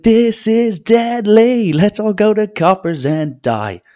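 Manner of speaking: high, slow, hammed-up voice of doom; mock doom-monger. Joking about people panicking over the virus, hammed-up doom voice. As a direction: play the panicked prophet of death, slow and high. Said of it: "go around and be like".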